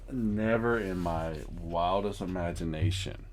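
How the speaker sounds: normal talking voice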